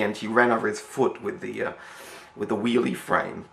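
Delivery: ordinary delivery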